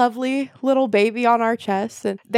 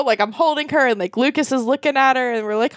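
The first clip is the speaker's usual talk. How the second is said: normal talking voice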